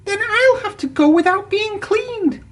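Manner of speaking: high, disappointed engine voice; unhappy. A narrator's reading. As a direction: sigh it out, let down. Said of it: "said Percy unhappily".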